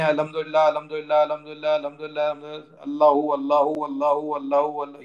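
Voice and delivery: mechanical monotone repetition of sacred words; imitating rote dhikr. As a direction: mimic someone chanting words by rote, on one even note. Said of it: "خالی word پکڑ لیں".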